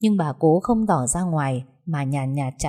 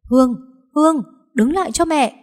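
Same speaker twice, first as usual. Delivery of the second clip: higher, louder call after her; 9 semitones higher, 6 dB louder than usual. Acted. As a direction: yell for her to stop. Mother calls after departing daughter. (gọi to, giọng cao gọi con đứng lại)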